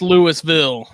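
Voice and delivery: "Louisville" loud and slow, LOO-iss-vill; pronounced Lewis-ville. Shown as a demonstration. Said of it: "It's pronounced Louisville".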